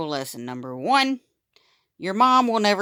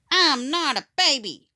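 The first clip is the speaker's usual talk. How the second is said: complaining; 10 semitones higher than usual. A narrator's reading. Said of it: "Steve complained".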